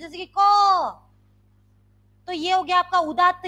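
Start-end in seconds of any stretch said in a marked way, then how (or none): 0.4-1.0 loud, sustained क; very forceful. Shown as a demonstration. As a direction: push the syllable out hard and hold it. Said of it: "बहुत जोर से बोलते हैं". (बहुत ज़ोर से, खींचकर)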